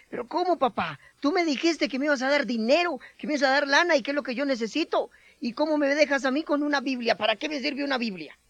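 reclamo indignado, más agudo; hijo enojado. Acted. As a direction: protesta furioso al padre que le regaló una Biblia. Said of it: "se enojó terriblemente y le dice". (reclamo enojado, voz más aguda)

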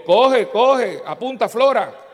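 delighted shout of approval; excited laughing cheer. Retold or imitated. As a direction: cheer the girl on through a laugh. Laughing praise of the girl's retort.

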